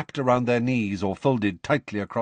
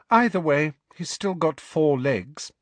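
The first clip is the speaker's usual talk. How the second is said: cool; 7 semitones higher than usual. A narrator's reading. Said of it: "said Hermione coolly".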